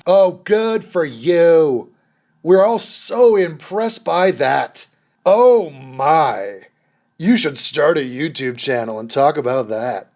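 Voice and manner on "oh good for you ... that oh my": slow, drawn-out mock admiration; heavy sarcastic gushing; sarcastic praise. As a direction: stretch the words to pretend you're impressed. Mocking sarcastic congratulations.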